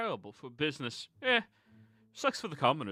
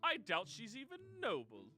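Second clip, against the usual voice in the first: pompous declaration. Acted. Spaulding proclaiming his presence to the whole line, pompous. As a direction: announce yourself grandly.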